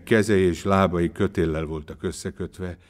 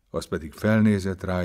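ordinary delivery

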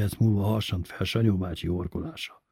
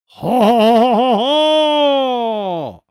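long, drawn-out high roar of ha ha; roaring mock laugh; 8 dB louder, much slower than usual. A narrator's reading. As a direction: bellow each ha slowly at full force like a prank. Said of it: "teljes erejéből elordította magát".

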